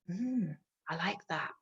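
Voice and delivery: soft, savouring puppy voice; contented puppy. Acted. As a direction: play a puppy quietly enjoying a treat. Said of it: "And the puppy's like".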